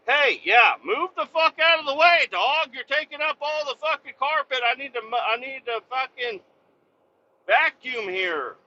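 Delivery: mock owner yell. Owner yelling at the dog blocking the vacuum. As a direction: bark it at the lazy dog. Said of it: "It's like".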